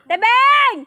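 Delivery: loud high call across the yard. Real, not acted. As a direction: call her name loudly. Calling out to a neighbor.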